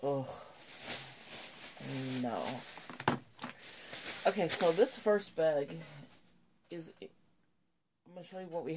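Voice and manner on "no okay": usual speaking voice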